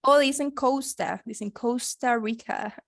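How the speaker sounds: Costa with a long English OU, slow. Shown as a demonstration. Teacher mimics Americans saying Costa Rica, slowly. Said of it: "Como con una O".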